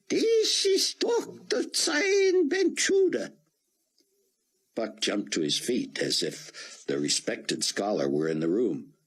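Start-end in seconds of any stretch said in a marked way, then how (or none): 0.1-3.3 put-on much higher, slow, heavy Hebrew accent; a frail old rabbi straining through a thick Hebrew accent. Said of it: "came the thick Hebrew accent".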